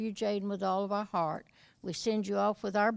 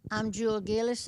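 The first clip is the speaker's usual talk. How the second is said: ordinary talk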